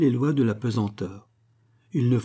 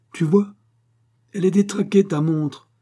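higher, louder squabbling voice; quarrelsome retort; 7 semitones higher, 6 dB louder than usual. A narrator's reading. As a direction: argue the point heatedly. Couple quarrel over the watch.

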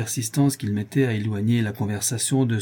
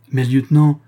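louder, urgent interruption; eager exclamation; 4 semitones higher, 10 dB louder than usual. A narrator's reading. Maltese sailor interrupts his lieutenant, eager exclamation. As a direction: cut in quickly to object. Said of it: "s'écria le Maltais en m'interrompant avec un empressement".

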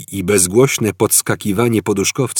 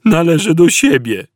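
high, loud tinny robot voice; shrill little robot answer; metallic squeaky robot; 10 semitones higher, 6 dB louder than usual. A narrator's reading. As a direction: squeak it out like a machine. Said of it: "metalicznie odezwał się piskliwy głos".

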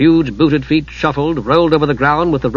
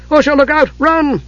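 urgent cry; 12 semitones higher than usual. A narrator's reading. Palin shouting to Usha to run. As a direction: yell a warning to her. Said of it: "he cried".

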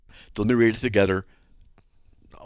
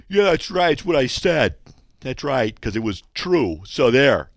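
loud, mock indignant comeback; imagined defiant emailer; 4 semitones higher, 6 dB louder than usual. Host imitates an emailer writing back, mock defiant. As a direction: dig in, hammed-up and emphatic. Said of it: "I don't know if they talk like".

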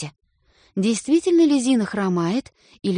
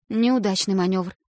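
ordinary delivery

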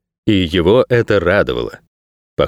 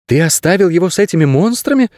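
put-on high-pitched indignant girl; mildly outraged question in a high girl's voice; 10 semitones higher than usual. A narrator's reading. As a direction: voice the girl protesting, pitched well up. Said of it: "возмутилась девчонка".

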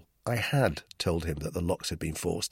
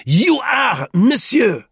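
high, loud, measured accusation; deliberate; 11 dB louder, slower than usual. A narrator's reading. Duclos accuses Roux, loudly deliberate. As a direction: place each word deliberately at full voice. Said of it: "he said deliberately".

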